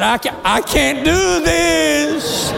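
high, slow, drawn-out mock despair; exhausted wail. Adult breathing hard under a child, wailing exhausted. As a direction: drag it out high as if spent.

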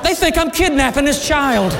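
high-pitched comic alarm; mock panic. Adult noticing passers-by staring, comically alarmed. Alarmed thought as people stare.

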